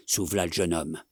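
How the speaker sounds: ordinary delivery, nothing marked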